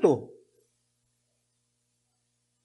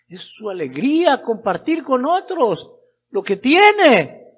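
raised, ringing exhortation; fervent preaching; 7 semitones higher, 9 dB louder than usual. Real, not acted. Preacher urging brethren to express what they hold, fervent preaching. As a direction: urge the congregation to open up.

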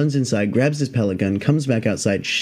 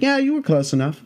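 higher-pitched, breezy handler voice; unbothered snake handler; 12 semitones higher than usual. Retold or imitated. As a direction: imitate a pro who finds this routine. Handler answers casually.

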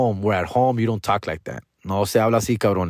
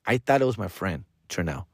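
usual speaking voice

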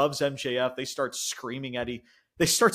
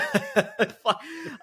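laugh; 11 semitones higher than usual. Real, not acted. Laughing at the retold mockery.

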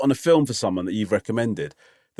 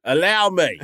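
grand host insistence; 4 semitones higher, slower than usual. Acted. Playing the self-appointed host.

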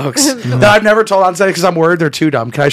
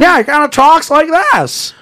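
high-pitched film-star impression; Jimmy Stewart; 9 semitones higher than usual. Shown as a demonstration. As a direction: demonstrate the actor's voice, pitched well up. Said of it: "have you heard Jimmy Stewart before".

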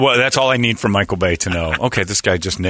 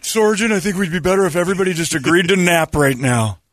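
whiny soldier; 9 semitones higher than usual. Pretend. Soldier answering sergeant.

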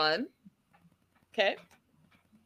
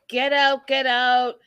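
raised, higher retold command; insistent mock yell; mock shouted order; 4 semitones higher, 5 dB louder than usual. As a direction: act out ordering someone out, louder. Retelling Usman ordering Angela out.

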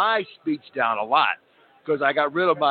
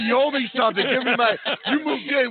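pushy, high, raised mock Philly neighbor; demanding Philly neighbor; 8 semitones higher than usual. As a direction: demand your pie like a tough Philly local.

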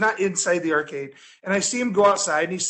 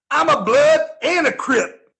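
higher, louder, slow tough-guy boast; imitating the bum; 7 semitones higher, 6 dB louder than usual. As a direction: puff up like a street bum bragging. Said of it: "the bum looks at Tut and he goes".

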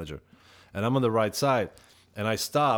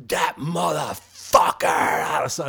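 slow, drawn-out furious roar, higher pitched; fuming outburst; 5 semitones higher, much slower than usual. Retold or imitated. Snubbed singer storming onto the bus, fuming. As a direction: retell it as a seething eruption. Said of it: "I started fuming".

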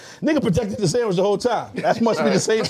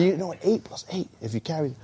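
lower, softer pretend working-it-out; mock calculating; 4 semitones lower, 7 dB quieter than usual. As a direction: lightly mimic someone adding it up under their breath. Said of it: "You was just building and building and building".

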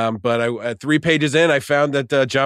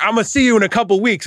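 mock menacing threat; 10 semitones higher, 5 dB louder than usual. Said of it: "And it was like".

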